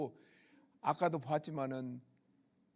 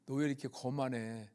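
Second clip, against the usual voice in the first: low, soft voice lightly playing God; God gently chiding; 6 semitones lower, 6 dB quieter than usual. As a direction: quietly imitate a fatherly reproof from God. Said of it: "하나님이 뭐라고 하시는 거예요".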